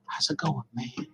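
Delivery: soft, mumbled imitation of a buddy; imitating his talk. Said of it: "That's how he talks".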